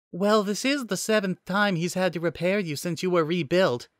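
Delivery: high-pitched AI assistant; Nicole the AI, pointed reminder. Acted.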